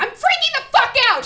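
high, loud panicked yell; freaking out. Retold or imitated. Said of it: "I was like".

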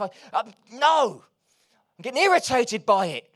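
loud, high, frustrated preacher's cry; fiery preaching. Acted. Preacher voicing frustration to the church, loud and fiery. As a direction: rattle their cage with your voice.

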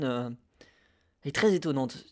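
ordinary talk, nothing marked

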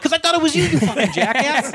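very high, exasperated squawk; mock outraged yell. Man to buddy who asked about kicking, mock outrage. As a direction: blurt it out in comic outrage, half laughing. Retold outburst at his buddy.

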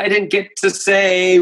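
usual speaking voice

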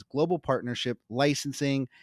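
ordinary talk, nothing marked

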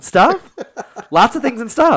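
incredulous. Real, not acted. Incredulous echo.

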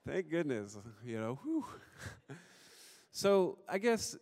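ordinary delivery

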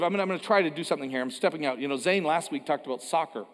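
ordinary talk

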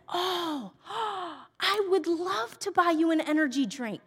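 high, soft, gushing sweetness; very nice woman. Retold or imitated. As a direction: imitate a sweet cashier, gasping with delight. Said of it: "The nicest woman I've ever met".

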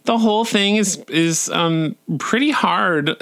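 high, hesitant whine with nervous giggles; whining. A narrator's reading. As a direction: whine nervously, stumbling over ums. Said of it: "To which the soloist whined".

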